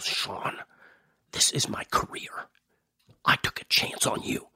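urgent whispered warning from mom, voiceless; stern whisper. Retold or imitated. Mom pulling her son outside, urgent whisper. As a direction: hiss it low so the crew can't hear. Mom pulls him aside on set.

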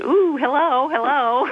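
high, gushing hello like a happy dog; eager dog greeting. Retold or imitated. Caller voicing her dog greeting a visitor, high and gushing. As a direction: coo it out the way an excited dog would greet.

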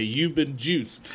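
slow drawn-out catchphrase reveal; mock O J gotcha line. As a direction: draw it out slowly like a TV gotcha. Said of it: "and he would say".

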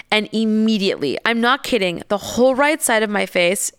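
ordinary talk

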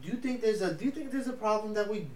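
ordinary talk

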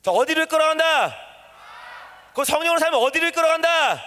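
high, insistent call-and-response shout; rousing call. Shown as a demonstration. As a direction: demand the answer from the whole crowd. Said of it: "더 크게".

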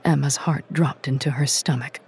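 plain reading voice

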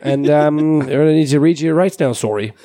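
put-on muddled Canadian accent drifting into Irish; mixed Canadian-Irish accent. Polite Canadian police officer arresting a driver, muddled Canadian-Irish accent. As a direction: do an apologetic Canadian cop, accent sliding Irish. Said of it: "weird mix of Canadian, some form of Irish".

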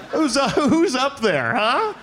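high laughing taunt, slow; mock heckle. Passenger heckling the pilot, high teasing taunt. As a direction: jeer up at the cockpit, laughing.

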